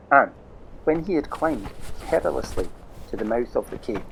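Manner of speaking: plain reading voice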